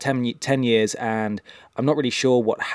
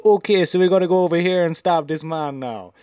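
high-pitched mock Jamaican; terrible Jamaican game-character impression; bad Jamaican accent; 7 semitones higher, 4 dB louder than usual. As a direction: lurch into a hammed-up Jamaican accent. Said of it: "she was straight back to".

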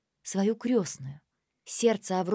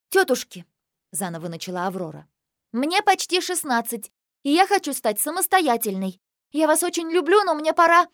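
higher, louder, insistent; earnest rehearsed plea; 8 semitones higher, 6 dB louder than usual. Acted. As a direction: declare it with conviction. Second rehearsal, more firmly.

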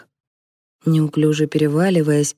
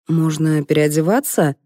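usual speaking voice

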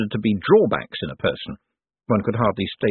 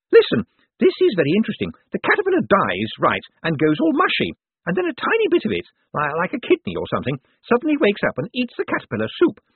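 high, impatient woman's voice; bossy sister; 10 semitones higher than usual. A narrator's reading. Sister correcting her feverish brother about caterpillars, bossy. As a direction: lecture him briskly, pitched well up.

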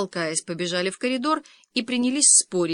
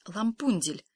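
ordinary delivery, nothing marked

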